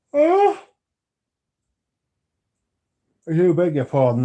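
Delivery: mock outrage. Teasing a chatter who yawned, in hammed-up shock. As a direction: play at being scandalised.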